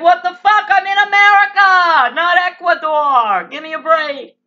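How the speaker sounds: loud, high, indignant yell; acted outrage. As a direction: shout it back in mock outrage. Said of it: "I'll be like".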